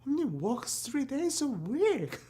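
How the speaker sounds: very high, squeaky swooping repeat; silly high voice. Acted. Comic repeats how little the inventor works, silly high voice. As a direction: send the whole line up into falsetto.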